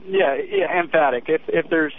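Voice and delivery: ordinary delivery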